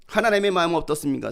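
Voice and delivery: normal talking voice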